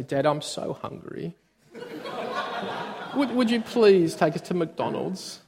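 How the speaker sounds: soft, laughing mock whine; fake whimper. Kid fake-crying to dad for McDonald's, whiny. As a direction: pretend to be a pitiful hungry kid. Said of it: "cry a little bit".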